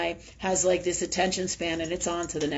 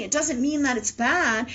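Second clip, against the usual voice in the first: ordinary talk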